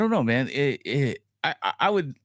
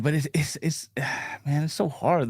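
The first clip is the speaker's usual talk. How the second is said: ordinary talk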